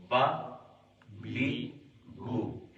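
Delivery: با بی بو clipped short, one beat each, no stretching; short correct reading. Shown as a demonstration. Teacher showing students the right way after the stretched one, short and crisp. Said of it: "کھینچ کے نہیں پڑھنا".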